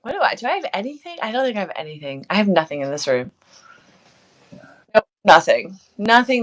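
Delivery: ordinary delivery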